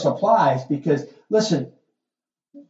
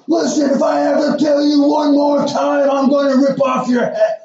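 loud, high shouted threat; yelling parent; 13 semitones higher, 6 dB louder than usual. Acted. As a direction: holler like a parent who has lost all control. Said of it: "they're the ones yelling".

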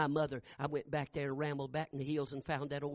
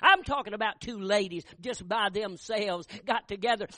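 louder, higher preacher's build; rising preaching; 7 semitones higher, 10 dB louder than usual. Acted. Preacher building to full force about the praying ladies. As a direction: drive it up into a shout of praise.

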